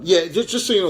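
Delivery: normal talking voice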